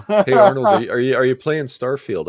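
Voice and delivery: loud, high-pitched hail; calling out. Real, not acted. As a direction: call out to get the guest's attention. Host calling out to Arnold.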